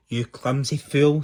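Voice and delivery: put-on loud, angry scolding from an old lady; screeched insult. A narrator's reading. Old lady knocked over by Angry, screeched insult. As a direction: scold loudly, outraged. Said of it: "screeched the old lady".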